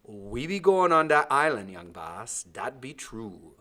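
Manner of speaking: loud, firm and steely, then slow; steel in his voice. A narrator's reading. Timothy firmly refuses the boy's wish to stay, steely. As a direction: insist with steel, not budging. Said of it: "There was steel in his voice".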